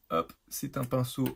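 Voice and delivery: ordinary delivery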